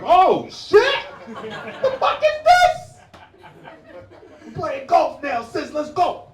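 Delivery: very high, loud mock amazement; excited shout. Kid to sister on seeing Tiger Woods, excited high shout. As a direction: yell it out like a thrilled kid.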